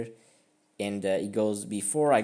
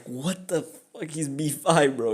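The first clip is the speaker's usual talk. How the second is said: higher, drawn-out mock disbelief; imitating baffled opponent; 4 semitones higher, 3 dB louder than usual. Opponent reacting to the blunder, acted bewilderment. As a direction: act out an incredulous opponent. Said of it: "He was like".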